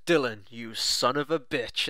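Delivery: slow, drawn-out action-hero greeting, lightly voiced; movie line impression. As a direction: lightly imitate a film hero hailing an old friend. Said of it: "He goes".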